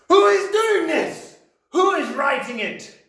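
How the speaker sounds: very high, loud frantic demand; yelling Trunchbull. A narrator's reading. Headmistress demanding who is writing, loud high yell. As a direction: scream the questions at nobody in particular. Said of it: "she yelled at nobody in particular".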